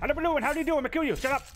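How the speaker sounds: high, loud playful babble; mock-menacing high-pitched rush; manic taunting. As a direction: taunt the victim in a high, gleeful voice. Taunts enemy while attacking it.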